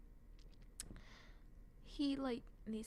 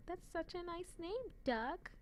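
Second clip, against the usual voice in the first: high, sweet quoting of a character; high mock character voice; 9 semitones higher than usual. Vlogger quoting an anime character, high sweet voice. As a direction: sing it up high like a charmed anime girl. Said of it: "when they were like".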